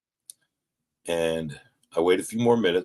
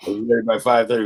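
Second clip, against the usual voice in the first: laughing; 3 semitones higher, 5 dB louder, faster than usual. Real, not acted. Laughing retelling of being ready.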